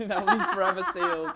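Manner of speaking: laughing it off, voice jumping up. Real, not acted. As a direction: giggle through the words, keeping the secret. Laughing off a question about mum.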